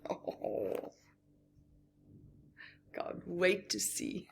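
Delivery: plain reading voice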